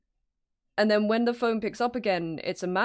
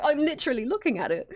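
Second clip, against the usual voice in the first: higher-pitched imitation of someone insisting; lightly mock exasperation, stressing "looking"; retold exasperated insistence; 7 semitones higher than usual. Corrected quote of Chester's exasperated insistence.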